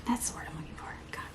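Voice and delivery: a hushed relieved aside; whispered aside. Real, not acted. Finding the word, a whispered aside. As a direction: whisper it to yourself.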